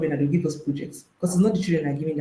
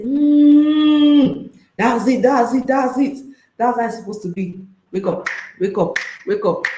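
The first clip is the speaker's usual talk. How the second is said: high, loud, hammed-up moans of pleasure; mock moaning lover; 6 semitones higher, 5 dB louder than usual. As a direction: overdo the bedroom voice, breathy-free but loud and high. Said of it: "You will now say".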